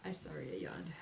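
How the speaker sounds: sheepish whisper; whispered apology. Real, not acted. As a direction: whisper sorry after yawning. Apology whispered after a yawn.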